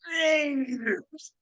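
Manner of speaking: high, drawn-out growing call sliding down; Al Davis impression. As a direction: imitate his odd one-word greeting, stretched out high. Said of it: "he just goes".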